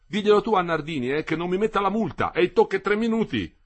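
put-on a breathless kid pleading his excuse; young boy Renzoni. Boy Renzoni pleads, arriving late.

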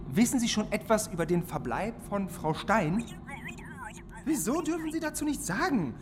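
ordinary talk

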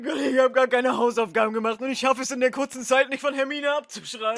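panicked. Acted. Ron panics about homework.

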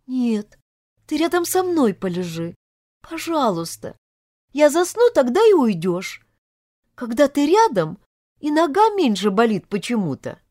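put-on high, coaxing girl's voice; pleading heroine. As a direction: coax him to stay close, pitched high. (девушка упрашивает высоким голосом)

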